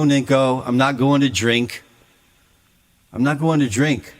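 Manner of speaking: ordinary talk, nothing marked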